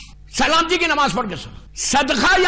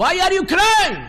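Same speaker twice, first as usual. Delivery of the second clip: ordinary delivery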